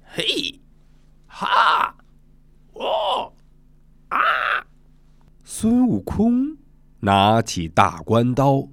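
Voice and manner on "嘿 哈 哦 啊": sharp high "hey, ha, oh, ah" cries; short separate martial-arts yells; monkey drill shouts. Acted. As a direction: monkeys calling out their training moves. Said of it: "群猴在水帘洞前又练开了".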